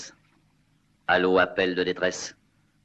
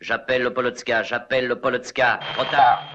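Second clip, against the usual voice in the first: desperate calling; 6 semitones higher than usual. Acted. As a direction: call out in rising panic. Pilot calls desperately, losing contact. (appel désespéré, de plus en plus paniqué)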